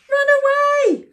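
storybook cry of alarm; acted animal panic; panicked cry. A narrator's reading. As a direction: urge everyone to flee. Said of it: "cried the forest animals".

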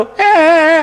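put-on high childish bawling; mock crying. Scolded pupil bursts into tears, mock crying. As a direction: imitate a schoolboy starting to wail. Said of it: "છોકરો રડવા માંડ્યો".

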